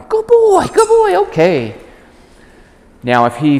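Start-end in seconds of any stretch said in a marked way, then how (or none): 0.1-1.7 excited praise. Real, not acted. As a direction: gush over the dog as he lets go.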